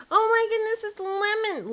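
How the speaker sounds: high, loud delighted cry; thrilled high greeting; excited squeal. Real, not acted. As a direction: shriek with joy at a friend arriving.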